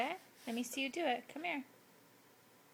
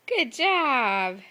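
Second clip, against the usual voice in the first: delighted gasp, then a loud good job; gasp then praise; 3 semitones higher, 9 dB louder than usual. Real, not acted. Mother cheering her toddler for taking it off, gasp and loud praise.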